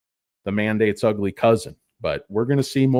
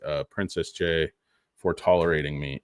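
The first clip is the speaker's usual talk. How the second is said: ordinary talk, nothing marked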